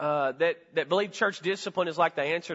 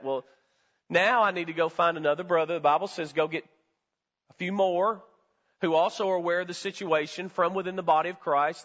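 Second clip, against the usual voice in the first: ordinary talk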